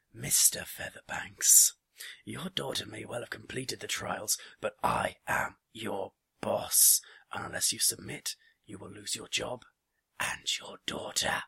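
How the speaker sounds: loud, measured, menacing address; calm threat. A narrator's reading. Boss threatening his employee's job and daughter, measured menace. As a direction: threaten him deliberately, stretching key words. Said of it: "and then said calmly".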